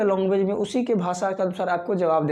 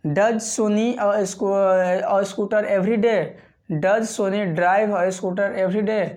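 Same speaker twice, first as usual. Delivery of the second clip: very slow, word by word, for learners; slow teacher read; much slower than usual. Shown as a demonstration. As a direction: spell each word out slowly like a teacher. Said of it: "next question क्या है". (धीमे-धीमे, हर शब्द साफ़ बोलते हुए)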